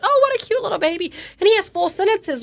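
put-on high, quick gush over a baby; cooing stranger. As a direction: imitate someone fussing over a cute baby.

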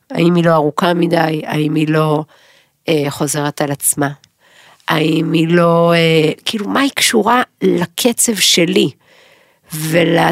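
usual speaking voice

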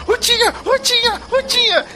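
high frantic calling. Acted. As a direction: shout the name while running off. Dudu runs off calling.

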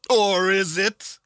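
drawn-out spooky voice; slow ominous echo. Acted. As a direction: stretch it out, menacing and slow. Ominous echo of own question.

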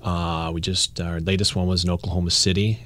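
ordinary talk

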